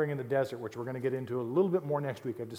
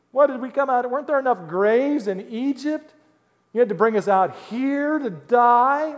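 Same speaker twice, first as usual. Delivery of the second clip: mock whining; 10 semitones higher, 12 dB louder than usual. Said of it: "What a bunch of whiners".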